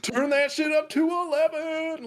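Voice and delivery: high mocking shout, pushed way up; mock hype yell. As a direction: crank it like an overexcited fan. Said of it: "and they were like".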